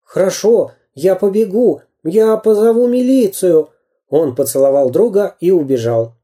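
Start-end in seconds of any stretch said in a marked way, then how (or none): 0.0-3.7 put-on higher boyish voice, urgent; a lively boy hurrying for help; boy's voice. A narrator's reading. Karandash tells his friend he'll run for police, boyish.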